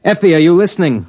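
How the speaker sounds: high, loud impatient call. Acted. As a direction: snap her back to attention.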